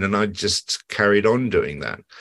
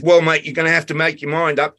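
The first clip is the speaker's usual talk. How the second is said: quoting the spark; 8 semitones higher, 3 dB louder than usual. Retold or imitated. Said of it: "He says".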